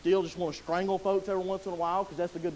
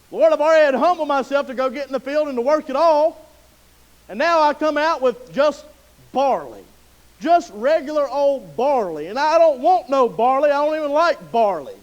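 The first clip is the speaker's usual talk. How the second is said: complaining Ruth; 7 semitones higher, 10 dB louder than usual. Acted. Ruth voiced complaining about barley.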